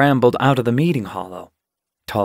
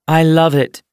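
enthusiastic; 5 semitones higher, 6 dB louder than usual. A narrator's reading. She declares her love of tunnels.

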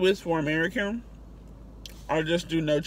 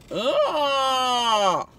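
loud high ugh; ugh exclamation; 7 semitones higher, 5 dB louder than usual. Real, not acted. Reacting to the croissant for viewers, loud ugh.

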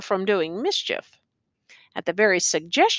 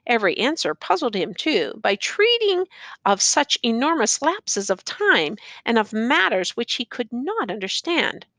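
ordinary delivery

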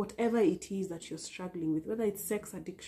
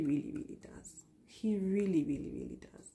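whispered assurance; 4 dB quieter than usual. Real, not acted. Assuring the viewer God loves them, in a whisper. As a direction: breathe it out as a tender secret. Emotional assurance of God's love.

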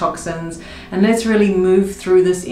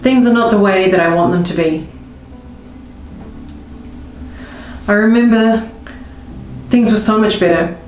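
guided tapping script. Acted. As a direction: voice the viewer's hurt, then surrender it gently. Tapping script in first person.

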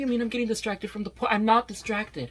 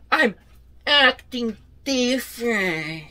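unnatural acting; 4 dB louder, slower than usual. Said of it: "You're not acting natural".

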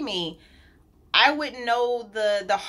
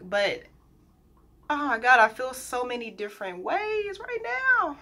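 usual speaking voice

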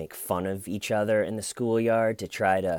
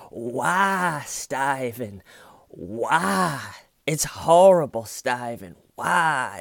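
high-pitched, hammed-up anguish; despairing mock wail; 5 semitones higher, 5 dB louder, much slower than usual. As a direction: imitate a detective who has hit bottom. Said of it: "all he can really go is just go".

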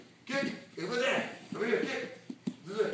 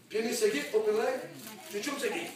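ordinary talk, nothing marked

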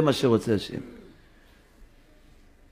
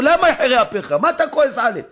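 Moses pleading; 11 semitones higher than usual. Acted. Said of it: "אמר לו משה לקדוש ברוך הוא".

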